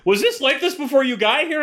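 hammed-up high questioning nurse; mock nurse question. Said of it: "they're gonna be like".

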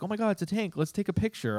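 high giddy onlooker; mock excited bystander. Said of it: "people are like".

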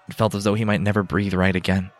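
ordinary delivery, nothing marked

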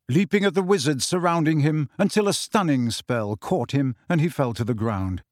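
ordinary delivery, nothing marked

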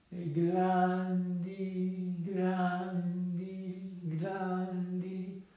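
very slow, stretched chant; sleepy, held-out storybook syllables; drawn-out sound effect. A narrator's reading. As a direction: stretch every word out, fading gradually. Narrator imitates goat's slow droppings.

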